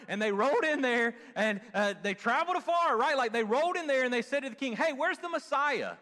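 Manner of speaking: high, loud, driving sermon delivery; fired-up preaching. Real, not acted. Preacher telling who should have known the Messiah, fired-up preaching.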